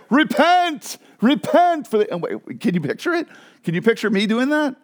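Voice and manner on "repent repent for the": street preacher voice. Pretend. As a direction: bellow like a sandwich-board prophet. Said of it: "I have a voice for it".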